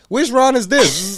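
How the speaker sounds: high, slow puzzled mock voice; imitating Mila. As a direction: play her dopey confusion. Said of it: "He was like".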